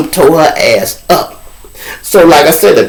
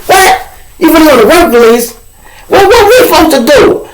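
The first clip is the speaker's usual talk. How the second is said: much higher, louder, fast disbelieving voices; incredulous inmates; 4 dB louder than usual. Retold or imitated. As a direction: squeal it like you can't believe he's leaving. Inmates react to his release.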